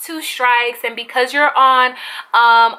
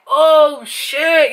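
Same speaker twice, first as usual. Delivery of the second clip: drawn-out, louder stunned reaction; imitated shock; 3 semitones higher, 4 dB louder than usual. Bobby watching the argument from behind, imitated shock. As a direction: mimic a stunned onlooker, pitch up. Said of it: "that was his facial expression".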